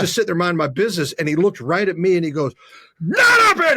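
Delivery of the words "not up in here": high, loud full-voiced yell; explosive high yell; imitated furious shout. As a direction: bellow it back at the heckler. Joey Porter snapping back at him.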